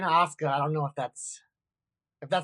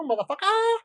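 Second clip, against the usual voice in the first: triumphant flourish; 12 semitones higher than usual. Real, not acted. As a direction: send the last word soaring to cap the speech. Triumphant end to his hopes speech.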